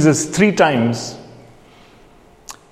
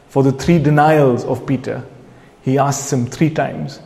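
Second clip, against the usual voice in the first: usual speaking voice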